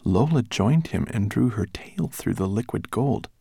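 ordinary delivery, nothing marked

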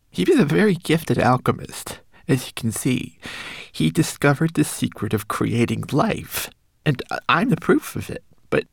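high, small homunculus voice; a tiny frightened creature warning. Acted.

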